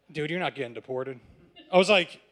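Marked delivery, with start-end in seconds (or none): 0.1-1.2 imitated officer, low and soft; low-key reassurance. Police officer telling arrested student he's staying, low-key. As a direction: drop it low and quiet, confiding.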